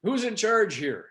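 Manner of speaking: called out high and loud, slowed; imitating a man shouting up across a roof; retold yell. Inspector calling across the roof, a mock yell. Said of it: "they yelled over".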